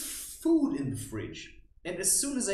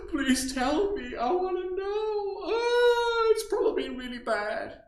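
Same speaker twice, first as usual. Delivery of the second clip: high, loud, pitched-up desperate whine with a cry; mock pleading; 6 dB louder than usual. Pretend viewer begging him to list reasons, high desperate whine. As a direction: beg like a frantic viewer, voice cracking.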